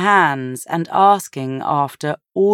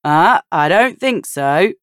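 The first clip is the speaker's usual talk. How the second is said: playful refusal; 4 semitones higher, 6 dB louder than usual. A narrator's reading. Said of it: "Ben said".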